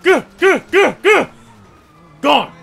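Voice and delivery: high, loud bursts of urgency; frantic urging. Real, not acted. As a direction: shout to get away right now. Urging escape during the chase.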